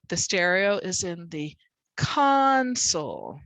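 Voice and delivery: "console" as CON-sole, CON held long; slow sentence landing hard on CON in "console"; first-syllable stress. Shown as a demonstration. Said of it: "it's louder and longer".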